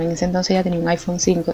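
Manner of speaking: ordinary talk, nothing marked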